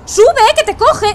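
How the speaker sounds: loud, high, drawn-out yell to climb; urgent shout. Real, not acted. Streamer yelling at the character to climb before capture. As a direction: yell at him to get up now.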